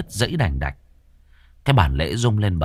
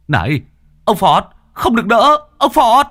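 much higher, loud alarmed shout; yelling out; 14 semitones higher, 8 dB louder than usual. A narrator's reading. Tẩy yells to stop sorcerer Phọt from striking, much higher and louder. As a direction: shout a desperate warning across the yard. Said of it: "Cậu rú lên".

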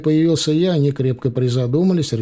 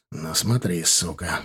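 low threat; 8 semitones lower, 6 dB quieter, slower than usual. A narrator's reading. Hero threatens thug.

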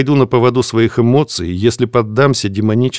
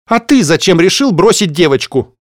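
angry retort; 7 semitones higher, 4 dB louder than usual. Acted. Second voice argues back.

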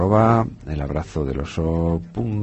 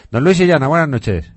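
raised, high calling voice; 9 semitones higher, 4 dB louder than usual. Real, not acted. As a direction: call out loudly to the caller. Calling out to caller on phone.

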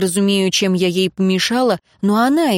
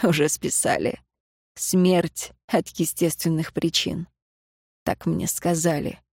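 low, soft, slowing delivery; subdued grief; 3 semitones lower, 7 dB quieter than usual. A narrator's reading. Ignat reporting his mother's death verdict, subdued. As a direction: sink into a quiet, low voice.

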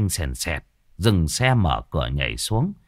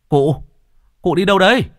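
put-on raised voice calling to the old man; 9 semitones higher, 6 dB louder than usual. A narrator's reading. Young man calls out to old Lục, loud. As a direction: shout after him on the road.

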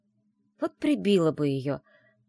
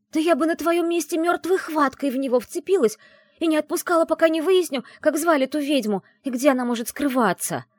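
high, loud, indignant outpouring; heated rant; 12 semitones higher, 8 dB louder than usual. A narrator's reading. Friend telling heroine what she should have done, heated rant. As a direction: scold a friend at full tilt.

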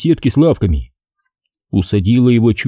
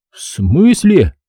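slow, raised bewildered question; stunned disbelief; 6 semitones higher, much slower than usual. A narrator's reading. Son just told his father has died, stunned question. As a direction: ask as if dumbstruck, stretching the words. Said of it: "Сашка ошарашенно смотрел".

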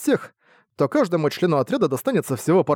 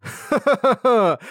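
slow, high hearty guffaw; big hearty laugh; 8 semitones higher, much slower than usual. Acted. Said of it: "захохотал".

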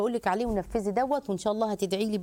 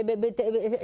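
ordinary delivery

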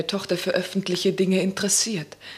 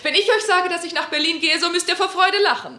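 higher, louder, gleeful taunting; triumphant taunt; 8 semitones higher, 3 dB louder than usual. Acted. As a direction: crow over them from on high. Lilli mocks the family triumphantly.

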